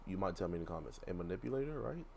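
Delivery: ordinary talk, nothing marked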